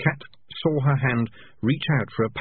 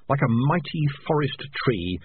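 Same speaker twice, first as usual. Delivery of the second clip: ordinary delivery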